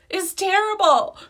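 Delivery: high, loud, slow moan of despair; dramatic lament. Real, not acted. To viewers about cow-like lashes, high loud slow lament. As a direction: drag it out in mock agony.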